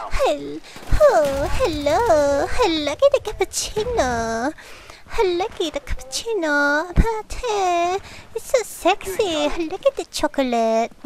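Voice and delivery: high-pitched sketch-character voice with a put-on accent; exaggerated, high mock-accented voice; Miss Swan. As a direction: imitate a comedy skit lady, pitched high. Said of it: "you know some of her skits".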